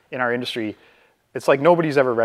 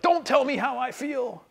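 much higher, slower, offended comeback; indignant retort; 11 semitones higher, 4 dB quieter than usual. Acted. Upset person rejecting a clumsy label, indignant and high. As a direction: snap it back like you've been patronized.

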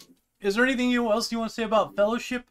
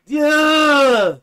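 high, very loud drawn-out cry; exasperated yell; 8 semitones higher, 11 dB louder than usual. Acted. Reader fed up with Tolkien's endless description, exasperated yell. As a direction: let the parody boil over into a yell. Parody boils over into a yell.

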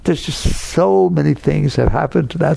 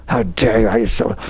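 mock yelling; 5 semitones lower than usual. Speaker acts out someone yelling at you, mock outraged. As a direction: imitate an angry telling-off at low volume. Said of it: "But yelling at you".

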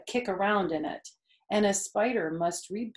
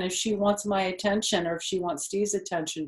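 usual speaking voice

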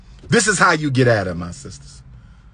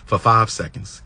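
ordinary delivery

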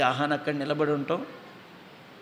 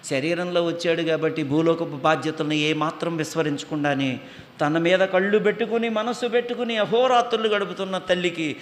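normal talking voice

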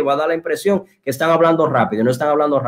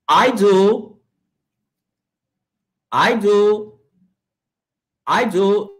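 higher-pitched drill voice on "I do"; listen-and-repeat model; 4 semitones higher, much slower than usual. Shown as a demonstration. Teacher asking students to listen and repeat, higher model voice. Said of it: "así que listen and repeat".

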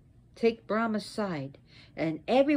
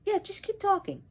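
sceptical mock aside; 9 semitones higher than usual. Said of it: "She was like".

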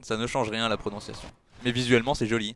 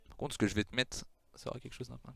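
mock threat; 10 dB quieter than usual.

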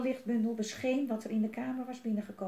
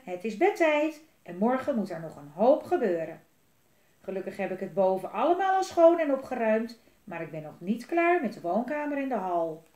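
a hint of a busy mother, a bit louder; mother voice, mildly raised; lightly voiced mother; 8 dB louder than usual. A narrator's reading. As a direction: mum telling the kids it is bedtime. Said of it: "klonk mama's stem".

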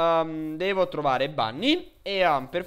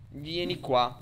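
usual speaking voice

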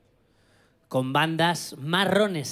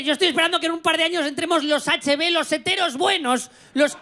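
high-pitched, insistent mock protest; comic indignation; 10 semitones higher than usual. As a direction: plead your case with escalating exasperation. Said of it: "me da mucha rabia".

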